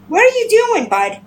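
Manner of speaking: high-pitched question to a pet; pet talk. Real, not acted.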